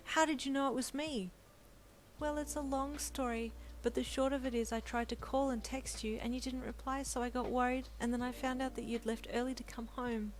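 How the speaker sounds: a wife telling her worried story; young wife, explaining. A narrator's reading.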